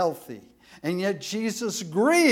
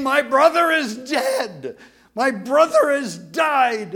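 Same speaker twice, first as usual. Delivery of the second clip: higher, louder wailing lament; voicing grieving Mary; 6 semitones higher, 6 dB louder than usual. Pastor voices Mary wailing over her dead brother. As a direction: cry it out like a grieving sister. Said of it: "involve the physicality of Mary".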